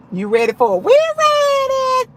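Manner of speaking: playful crowd cheer rising way up high; playful hype shout. Pretend. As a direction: hype up the room and answer as the crowd. Hyping up, answers as the crowd.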